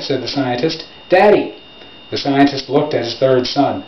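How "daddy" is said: lightly voiced child, higher and quick; child calling out. A narrator's reading. Third son calling his scientist father, lightly voiced child's call. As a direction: call for a parent's attention, pitched up.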